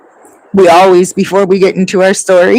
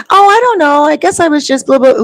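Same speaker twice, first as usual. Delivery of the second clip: much higher, louder shrugging reply; acting Julianne's voice; 9 semitones higher, 3 dB louder than usual. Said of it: "We even act out each person's voice".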